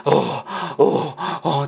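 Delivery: soft, panting puffs; out of breath. Shown as a demonstration. Teacher showing students what getting out of breath sounds like, panting. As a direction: pant lightly as if winded. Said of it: "just like that".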